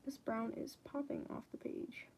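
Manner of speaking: soft whisper to herself. Real, not acted. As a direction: murmur it under your breath. Quiet aside while drawing.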